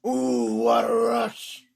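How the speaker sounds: low, loud, slow growling roar; entrance-music catchphrase. Retold or imitated. Host quoting the wrestlers' entrance music, deep roared catchphrase. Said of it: "what was Daniel's music in W W E".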